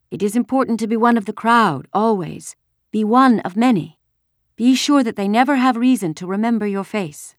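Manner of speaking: high, emphatic adult warning; urgent warning mother. A narrator's reading. Missus Rosen tells girls to blend in, insistently.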